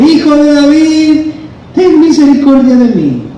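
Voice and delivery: very high, sustained cry for mercy; insistent acted yell. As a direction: call out desperately as the blind beggar, pitch way up. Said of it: "gritaba más fuerte".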